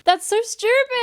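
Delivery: laughing, high and slow. Real, not acted. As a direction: laugh through the words. Laughing at the story.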